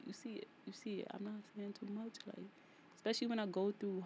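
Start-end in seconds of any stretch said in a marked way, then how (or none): 0.1-2.5 soft whispered aside; whispering. Acted. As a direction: drop to a hushed whisper. Hushed point, whispered.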